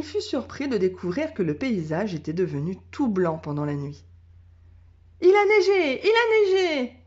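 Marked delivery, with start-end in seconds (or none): 5.2-7.0 high, loud joyful shout; excited cry. A narrator's reading. Little girl discovering snow, crying out high and loud. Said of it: "cria Bérénice".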